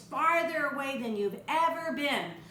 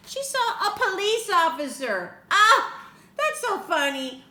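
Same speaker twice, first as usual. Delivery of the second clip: higher and louder big exclamation; excited reveal; 9 semitones higher, 8 dB louder than usual. A narrator's reading. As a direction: wow the kids with the surprise. Dramatic reveal to children.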